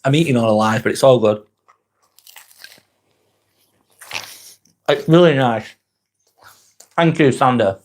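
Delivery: chewing while talking; mouth full. Real, not acted. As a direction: talk through a mouthful of food. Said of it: "gonna have a little bite".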